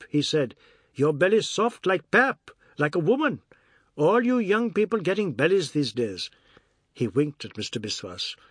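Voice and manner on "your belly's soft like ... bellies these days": higher, lilting jeer; mocking Ajoda. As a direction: poke fun in a raised character voice. Ajoda mocks the soft belly.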